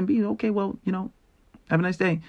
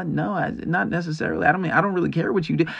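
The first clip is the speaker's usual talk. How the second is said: ordinary talk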